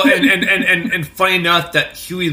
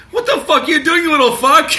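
much higher, louder laughing yell; mock furious yell; 13 semitones higher, 7 dB louder than usual. Friend retells a Seinfeld mother yelling at her kid, mock furious. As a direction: scream at the kid, hammed up and giggling.

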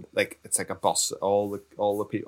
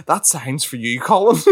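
teasing call; 5 semitones higher, 5 dB louder than usual. Retold or imitated. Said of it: "Andrew's pointing at the sign going".

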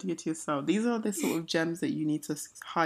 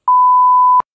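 shocked outburst; 19 dB louder, slower than usual. Real, not acted. Startled by Siri.

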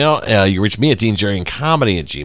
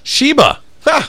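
excited; 5 dB louder, slower than usual. Real, not acted. Suddenly remembers the cat food name.

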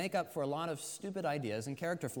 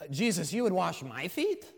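louder, disbelieving question with a rising end; incredulous question; 5 dB louder than usual. Acted. Peter as Jesus comes to him, incredulous. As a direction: ask it as if it is unthinkable.

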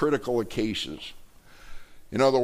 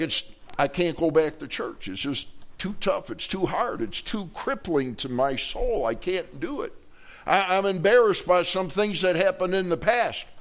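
higher-pitched voice of someone too embarrassed to return; mock-plaintive excuse, raised in pitch; embarrassed reluctance; 5 semitones higher than usual. Voices an embarrassed absent churchgoer.

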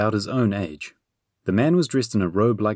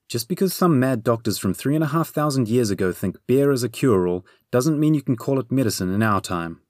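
higher-pitched nagging tirade; scolding Bernie, exasperated; 5 semitones higher than usual. Acted. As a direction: lecture the beer drinker in a raised pitch. Bernie's tirade about beer.

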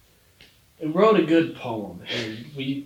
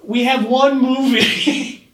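laughing; 10 semitones higher, 9 dB louder, slower than usual. Real, not acted. Corrects himself laughing.

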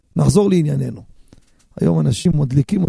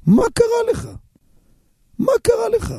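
much higher mocking cry; mock the fussy worrier, pitched up high; mock outcry. Preacher mocking corona strictness, high mock outcry.

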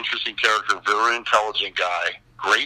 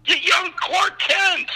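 very high, slow, stretched-out reply; high-pitched mock exasperation; exasperated insistence; much slower than usual. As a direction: imitate a director spelling out the obvious. Director insisting on the obvious.

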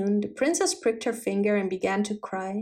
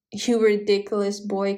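ordinary delivery

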